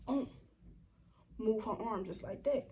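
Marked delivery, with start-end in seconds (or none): none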